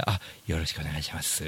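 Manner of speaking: soft, humble greeting; bow your head with this line; suddenly polite. Retold or imitated. (急にへりくだった丁寧な声)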